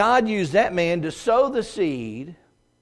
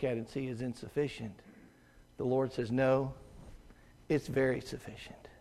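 soft earnest teaching; 5 semitones lower, 8 dB quieter than usual. Real, not acted. Preacher softly teaching the congregation, quiet and earnest. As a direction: lean in and say it softly, like a secret.

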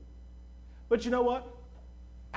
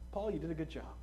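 soft, low imagined voice of God; quiet commendation; 4 semitones lower, 8 dB quieter, faster than usual. Retold or imitated. God looking on Paul's labor, quiet commendation. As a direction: give gentle, hushed praise.

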